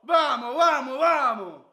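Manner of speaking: loud rousing cheer; triumphant shout. Real, not acted. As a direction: cheer them on loudly. Fan celebrating.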